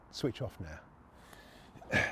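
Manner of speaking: low unvoiced hush; mock warning. Warning off the snowflakes in a low hush. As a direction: drop to a dry whispered aside.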